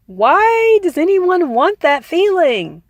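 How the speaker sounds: puzzled rhetorical plea; incredulous question. Real, not acted. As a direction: ask it as if it makes no sense. Rhetorical aside to listeners.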